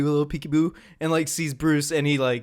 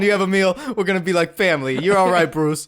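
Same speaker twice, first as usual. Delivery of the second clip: ordinary talk, nothing marked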